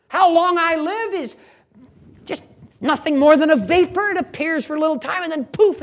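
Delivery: loud, high, impassioned declaration; forceful preaching. Acted. Preacher voices Joseph deferring to God, impassioned loud preaching. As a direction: proclaim it as Joseph, with fervour.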